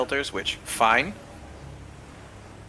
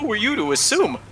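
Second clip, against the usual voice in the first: mock indignation; 8 semitones higher than usual. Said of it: "I was like".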